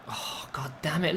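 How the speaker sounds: hissed under the breath; frustrated whisper; whispered curse. Real, not acted. Frustrated at the computer.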